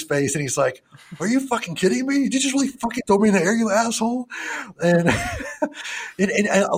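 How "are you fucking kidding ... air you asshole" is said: put-on high-pitched indignant baby; hammed-up disbelief, pitched way up; mock outrage. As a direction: play an affronted baby telling someone off. Voices outraged baby in film scene.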